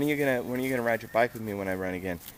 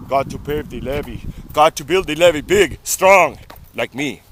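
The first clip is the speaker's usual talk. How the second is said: Schwarzenegger impression; 10 dB louder than usual. Mock Schwarzenegger joke.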